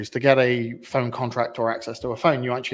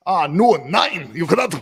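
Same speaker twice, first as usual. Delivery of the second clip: loud, higher mock German refusal; aggressive Northern German; 4 semitones higher, 5 dB louder than usual. German call centre turning a customer down, aggressive German accent. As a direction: bark it like a stern North German. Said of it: "a very aggressive Northern German".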